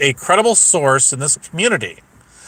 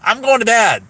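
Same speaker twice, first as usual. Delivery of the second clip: raised-pitch mimicry of a huffy woman; higher mock-huffy quote; mocking imitation; 6 semitones higher than usual. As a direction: imitate someone announcing they're storming off. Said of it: "And she's like".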